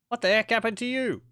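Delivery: high, loud incredulous question; startled disbelief. Real, not acted. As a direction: demand an answer from a fleeing unit. Sees own unit has fled.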